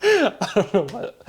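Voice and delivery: high, giggly, barely getting words out; helpless laughter. Real, not acted. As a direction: talk through a real laughing fit. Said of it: "That one made me laugh".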